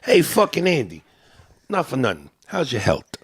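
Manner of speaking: Italian-American "health" ending in a hard T, "helt"; old-school Italian-American street greeting; Italian-American accent. Shown as a demonstration. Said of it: "They don't pronounce the H".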